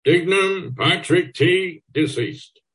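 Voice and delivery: slow, high, booming through a megaphone; megaphone call. A narrator's reading. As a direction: foghorn it stormily. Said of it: "foghorns stormily through his megaphone".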